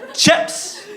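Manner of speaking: "Chips" very high with a rising end; different intonation. Shown as a demonstration. Poet desperate to order in London, different intonation on "Chips". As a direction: squeak out "Chips" as a last attempt. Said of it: "with a different intonation on each occasion".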